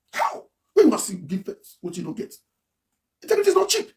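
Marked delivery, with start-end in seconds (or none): 3.2-3.9 slogan repeated far up in pitch; high-pitched slogan. Retold or imitated. Repeating the integrity slogan to viewers, high-pitched.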